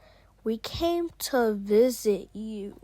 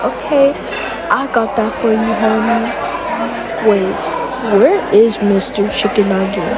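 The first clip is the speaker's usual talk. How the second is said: loud, eager fast-food cashier; 11 dB louder than usual. Acted. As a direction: shout the order back like a cashier.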